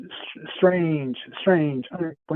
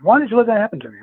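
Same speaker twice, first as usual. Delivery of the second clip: high, loud accusing question; retold louder reproach; 7 semitones higher, 6 dB louder, faster than usual. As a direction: imitate an alarmed, insistent demand. Retold upset woman repeats demand.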